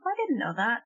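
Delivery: ordinary talk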